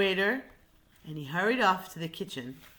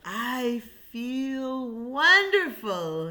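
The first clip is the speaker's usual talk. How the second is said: slow, higher and louder, satisfied; contented lion; 6 semitones higher, 7 dB louder, much slower than usual. Acted. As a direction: stretch it out, full and happy.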